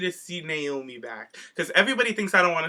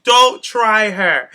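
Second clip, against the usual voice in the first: slow, louder warning, each word drawn out; 5 semitones higher, 8 dB louder, much slower than usual. Retold or imitated. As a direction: lay down the line to protect her favourite wrestler. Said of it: "And I was like".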